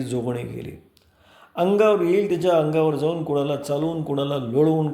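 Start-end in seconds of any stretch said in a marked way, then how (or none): 0.0-0.8 the same cry repeated, softer and lower, dying off; cry fading away. Acted. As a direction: let the alarm echo away into the distance. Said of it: "सर्वत्र एकच ओरड घुमली". (ओरड हळूहळू विरत जाणारी, हळू आवाजात)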